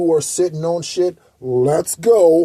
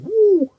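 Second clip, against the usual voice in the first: sudden high whoop of glee; excited woo. Real, not acted. Dreaming of faith as currency, a gleeful high whoop. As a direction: whoop like you just got rich. Imagining being rich.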